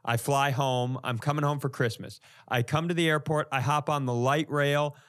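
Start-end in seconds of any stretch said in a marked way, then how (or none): none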